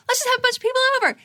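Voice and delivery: high, giddy invitation; over-the-top joyful excitement; excited, joyful. Acted. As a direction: gush an invite to everyone, way up high. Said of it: "I get so excited, so joyful".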